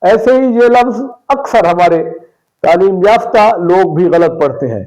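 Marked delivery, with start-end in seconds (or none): none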